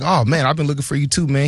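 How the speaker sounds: friendly, breezy reply; a hint of a cheerful exec; GM greeting warmly. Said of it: "he was like".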